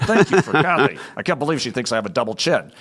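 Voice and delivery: loud, high, grudging mock gratitude; through gritted teeth. Husband thanking God for wife while still mad, gritted teeth. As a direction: force out thanks while fuming. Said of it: "with my teeth gritted".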